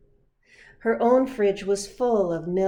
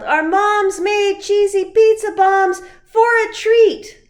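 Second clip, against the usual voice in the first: excited yell; 13 semitones higher, 14 dB louder than usual. Acted. Luis calling out to the girls about the treat, yelling. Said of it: "Luis yelled".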